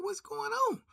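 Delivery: bewildered what's going on. Retold or imitated. Confused in chaos at the salad port.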